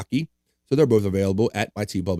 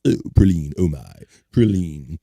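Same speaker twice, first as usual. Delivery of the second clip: mock fancy savouring; 7 semitones lower than usual. Savouring the other pronunciation.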